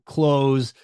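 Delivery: ordinary talk, nothing marked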